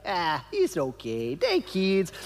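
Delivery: put-on slow, easy Nicaraguan English accent; imitating Cairo's laid-back Nicaraguan English accent. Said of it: "this Nicaraguan English accent".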